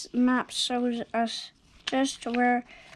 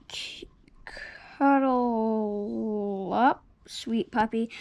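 tender; slower than usual. Acted. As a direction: coo it to the puppy slowly. Tender bedtime moment.

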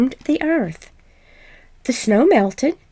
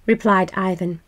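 plain reading voice

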